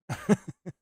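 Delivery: a sudden high laugh; high squeal of laughter. Real, not acted. After his witch story.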